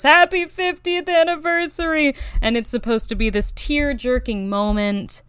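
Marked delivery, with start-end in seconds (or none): 0.0-2.2 a hint of a higher old man's voice; husband answering through the window, lightly acted; lightly voiced old husband. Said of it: "he goes".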